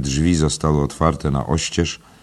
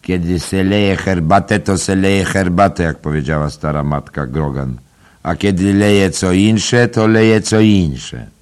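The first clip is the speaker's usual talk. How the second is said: put-on higher, louder fawning old crone voice, slowing at the end; wheedling old woman; 5 semitones higher, 6 dB louder than usual. A narrator's reading. Mulligan mimics old mother Grogan, high fawning crone voice. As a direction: coax like a toothless old granny. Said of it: "przypodchlebnym głosem starej kobiety".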